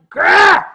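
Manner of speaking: high loud snarling grab noise; imitating the biter's lunge, loud growl; mock biting growl. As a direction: bark it like a lunging animal. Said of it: "he's like".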